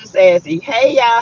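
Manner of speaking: ordinary talk, nothing marked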